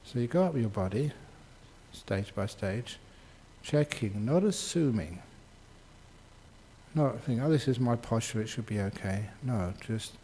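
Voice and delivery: soft, unhurried meditation guidance; quiet, easy voice with lots of space; guided meditation. Real, not acted. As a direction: talk them gently through a body check, leaving long pauses.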